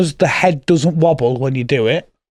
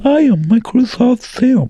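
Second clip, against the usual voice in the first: slow, high computer voice; Microsoft Sam; 5 semitones higher, slower than usual. Shown as a demonstration. Co-host repeating his party trick on request, computer voice. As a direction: imitate a text-to-speech robot, drawn out and high. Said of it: "Do that again".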